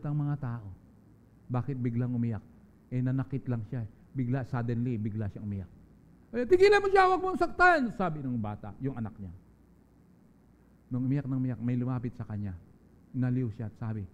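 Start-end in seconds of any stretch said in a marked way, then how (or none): 6.3-7.9 put-on high, loud cry of a child pleading; child's desperate shout. Child begs mother to stop the beating, desperate high shout.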